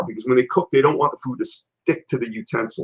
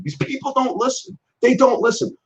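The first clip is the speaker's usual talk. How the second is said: high, fed-up complaint; exasperated; 10 semitones higher than usual. Real, not acted. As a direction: throw up your hands at them. Complaining about careless staff.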